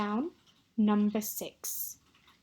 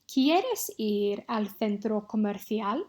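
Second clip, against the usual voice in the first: ¿Quieres ir al centro comercial? read slowly and clearly; slow dictation; slower than usual. Shown as a demonstration. Teacher dictates a sentence to students, slow and clear. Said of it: "say one of these six sentences".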